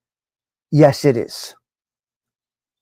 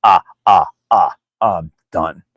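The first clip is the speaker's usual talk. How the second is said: Dean do impression; 10 semitones lower than usual. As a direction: sink low and groan it out, then quit. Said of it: "when we do Dean do".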